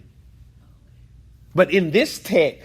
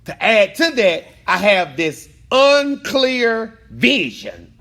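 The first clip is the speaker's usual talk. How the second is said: preaching, raised voice; 3 semitones higher, 5 dB louder than usual. Real, not acted. Teacher preaching emotionally.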